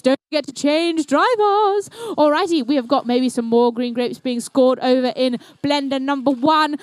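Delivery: ordinary talk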